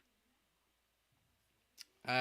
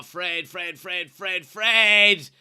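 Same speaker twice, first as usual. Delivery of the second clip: building shout; 3 semitones higher, 11 dB louder, much slower than usual. Real, not acted. Fan urging Fred on, building to a shout. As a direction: call his name louder and louder until you bellow it.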